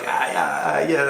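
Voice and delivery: imitating a shouting Italian driver; hammed-up retort to other drivers; mock angry shout. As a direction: throw it back at them, half-yelled. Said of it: "they're all screaming at each other".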